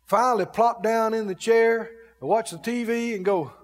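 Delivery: usual speaking voice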